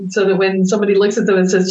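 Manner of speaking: usual speaking voice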